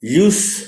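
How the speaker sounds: यूज़ with a buzzing z; यूज़ ending in a voiced z; use as verb. Shown as a demonstration. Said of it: "verb है तो यूज़ होगा".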